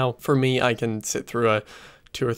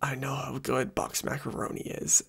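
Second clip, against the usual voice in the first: Kate's line, whispered under the breath; hushed whisper with no voice behind it; whispered aside; 8 dB quieter than usual. Retold or imitated. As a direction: breathe it out almost silently, like a guilty confession. Kate's reply retold as whisper.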